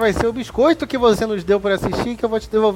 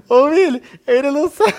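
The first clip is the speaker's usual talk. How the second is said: loud teasing call; 7 semitones higher, 6 dB louder than usual. Real, not acted. Teasing the clueless host.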